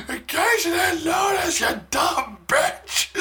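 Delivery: high, loud mocking sarcasm; sarcastic. Friend mocking the loud girl, high sarcastic jeer. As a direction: sneer it sarcastically. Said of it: "He was being sarcastic".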